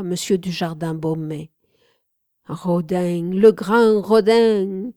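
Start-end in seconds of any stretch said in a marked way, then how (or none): 2.5-4.9 Rodin, le grand Rodin! flung out loud and slow; called out. Acted. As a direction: hail the master across the room. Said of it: "lança Bourdelle".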